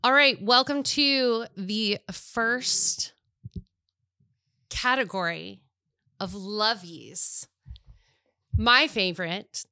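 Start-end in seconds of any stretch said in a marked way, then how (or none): 0.0-7.4 slow, grand announcer delivery; awards presenter. Acted. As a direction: announce the category with ceremony. Formal category announcement.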